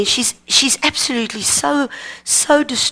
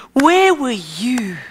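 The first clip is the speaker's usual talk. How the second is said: slow, drawn-out question to God; earnest, lingering prayer; prayerful plea; slower than usual. Retold or imitated. Retold prayer, question repeated slowly.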